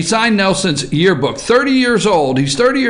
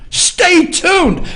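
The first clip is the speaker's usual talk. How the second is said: mocking media tease; 9 semitones higher than usual. Shown as a demonstration. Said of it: "That's what the media wants you to do".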